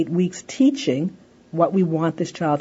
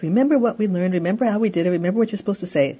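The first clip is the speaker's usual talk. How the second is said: fast, rattling through the reminders; rattled-off reminder; 3 semitones higher, much faster than usual. Shown as a demonstration. Parent rattling off the usual reminder speech. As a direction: rush the lecture off as a list. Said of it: "instead of the whole speech".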